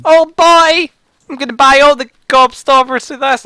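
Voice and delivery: put-on high, loud, eager little boy; excited boy voice. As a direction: squeal it like a thrilled kid. Monk boy again.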